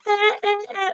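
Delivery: high, hammed-up porn moans; mock moaning. Mocking over-the-top moans in sex scenes. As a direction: parody an actress overdoing it.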